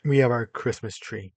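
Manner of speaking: normal talking voice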